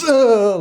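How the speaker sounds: loud, high mock groan; imitated straining grunt. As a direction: act out a crushing handshake noise. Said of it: "shakes his hand and goes".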